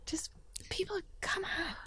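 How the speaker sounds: a hushed plea to foolish people; exasperated whisper. Real, not acted. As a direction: breathe it out in disbelief. Exasperated aside.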